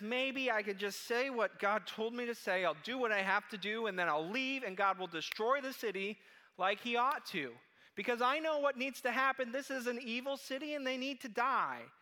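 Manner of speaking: grudging Jonah. Acted. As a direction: preach Jonah's resentful plan, rising.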